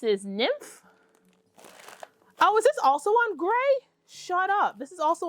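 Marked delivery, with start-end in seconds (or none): 2.4-5.3 high, loud gasp of disbelief; thrilled she found another one; delighted surprise. Real, not acted. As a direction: squeal of happy surprise. Discovers another gray-base braid.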